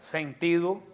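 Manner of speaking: ordinary delivery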